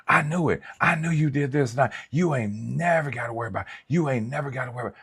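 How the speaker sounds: shrieky imitation of an accusing ex; mock girlfriend. Mimicking the angry girlfriend.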